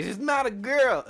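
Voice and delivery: drawn-out high yell; exasperated insistence. Retold or imitated. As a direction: wail it loudly, stretching the words. Friend insists, reenacted.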